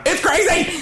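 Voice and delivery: very high-pitched squawked imitation of a yell; mock yell. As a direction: mimic him yelling, voice shot way up. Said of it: "he just yells one more time".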